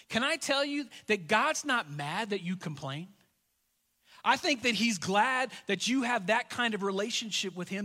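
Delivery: usual speaking voice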